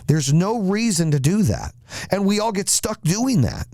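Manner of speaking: high-pitched, insistent, real frustration; exasperated rant. Real, not acted. Man done defending himself to online critics, exasperated. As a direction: let it pour out, pitched well up. Worked-up rant against his detractors.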